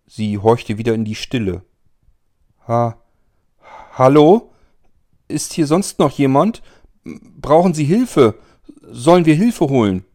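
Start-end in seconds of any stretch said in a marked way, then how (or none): 2.7-10.0 nervous call into the dark. Acted. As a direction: call out, hesitant then louder. Calling out into the dark.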